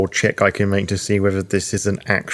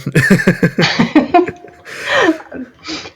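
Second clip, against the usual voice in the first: real burst of laughter; long, loud, high laugh; 5 dB louder than usual. Real, not acted. Player feels in the mud for the person, bursts out laughing.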